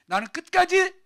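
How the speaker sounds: high, loud pledge; 베드로. Acted. Peter pledging to stay to the end, impassioned shout. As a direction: proclaim loyalty at full volume. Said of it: "막 이랬단 말이에요".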